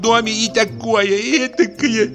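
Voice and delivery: high, imperious orders; a lady ordering her husband out, pitched high; commanding wife. Acted.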